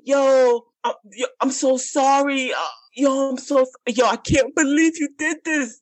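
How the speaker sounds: high, mock-sobbing apology; hammed-up pleading boyfriend; whiny boyfriend. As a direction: ham up his begging. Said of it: "he's like".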